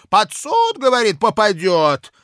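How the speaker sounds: put-on loud, high drunken bluster; drunken blustering official. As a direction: boast it loud like a tipsy clerk. Drunk Kozulin continues.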